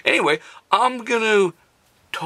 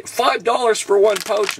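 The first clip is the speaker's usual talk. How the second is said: mock viewer shock; 5 dB louder than usual. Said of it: "Now you're gonna say".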